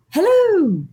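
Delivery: high, cheery sing-out greeting; friendly, happy voice. Retold or imitated. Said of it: "the most friendly, happy voice".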